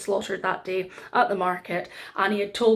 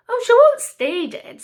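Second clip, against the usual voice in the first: cheerful Paik; 13 semitones higher, 8 dB louder than usual. A narrator's reading. Paik reassures shocked king.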